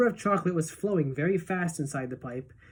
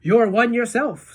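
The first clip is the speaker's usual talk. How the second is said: high, loud, slower jab; cheeky retort; 6 semitones higher, 7 dB louder, slower than usual. A narrator's reading. Wonka teases Violet, cheeky. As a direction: fire the insult back with glee. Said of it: "said Mister Wonka".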